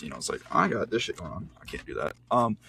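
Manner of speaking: ordinary delivery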